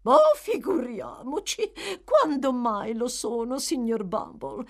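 high, disdainful exclamation; scoffing matron. A narrator's reading. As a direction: sniff at the ungrateful poor.

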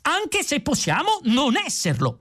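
high-pitched interjection; strained pedantic outburst; excitable philosopher. A narrator's reading. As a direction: butt in with a high, indignant voice. Excitable philosopher butts in to clarify.

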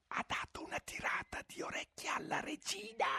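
very high, soft unvoiced rabbit whisper. A narrator's reading. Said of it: "cominciò il coniglio".

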